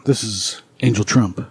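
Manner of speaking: low, slow seductive voice. Pretend. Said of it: "let me put my seductive voice on".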